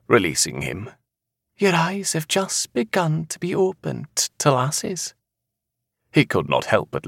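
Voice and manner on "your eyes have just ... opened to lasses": high Scottish seer voice; knowing seer. Acted. Seer correcting the man about lasses, high and knowing. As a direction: tell him high and assuredly.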